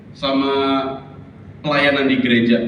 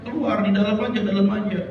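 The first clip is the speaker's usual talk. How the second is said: higher-pitched acted urging; imitated fussing husband; husband dissuading wife; 7 semitones higher, faster than usual. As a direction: tell her to stay put, pitched up. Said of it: "papah dia dan berkata".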